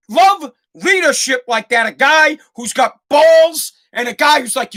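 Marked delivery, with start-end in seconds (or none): none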